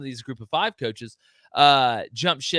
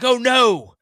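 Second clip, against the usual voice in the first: mock-panicked wail, higher and louder; imitating a panicking fan, higher and louder; 6 semitones higher, 8 dB louder than usual. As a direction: ham up a fan's dread. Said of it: "everybody's like".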